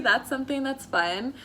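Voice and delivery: ordinary delivery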